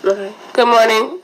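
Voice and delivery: ordinary talk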